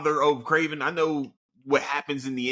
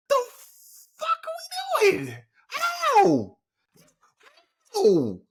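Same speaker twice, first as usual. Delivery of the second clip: high-pitched rant building to a screech; furious; 10 semitones higher, 3 dB louder than usual. Real, not acted. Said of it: "I'm so mad".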